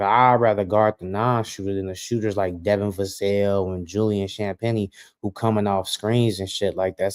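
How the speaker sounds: normal talking voice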